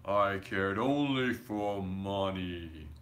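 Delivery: slow, low, hollow ghostly moan; slow ghost's lament. A narrator's reading. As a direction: groan it out like a chained ghost.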